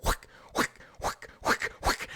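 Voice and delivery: imitating club hits in a breathy whisper; whispered whack sounds. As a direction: whisper each whack like a quick swing. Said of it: "and I go".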